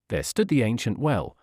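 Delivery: usual speaking voice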